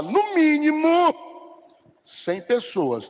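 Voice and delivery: preacher hammering home the goal; emphatic declaration. Real, not acted. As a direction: stretch out no mínimo to land it.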